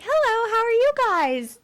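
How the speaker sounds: very high, chirpy on-air greeting; perky TV reporter. Retold or imitated. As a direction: imitate an over-bright interviewer. Imitates reporter greeting couple.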